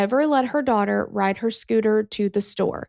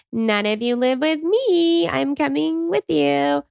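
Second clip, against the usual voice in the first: drawn-out mock mom voice, rising in pitch; overprotective mom; 5 semitones higher, slower than usual. Mom refusing to let grown kids go alone, mock overprotective. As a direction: stretch it out slowly, hammed-up clingy mother.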